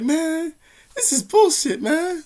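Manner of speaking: high, loud whine of a salty victim; whiny mock complaint. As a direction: imitate someone bitterly griping.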